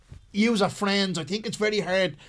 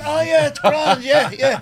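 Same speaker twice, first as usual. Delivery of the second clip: high, loud, laughing pretend reassurance; mock breeziness; 6 semitones higher, 7 dB louder, slower than usual. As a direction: brightly fake that all is well. Acts a dazed dad claiming all's fine.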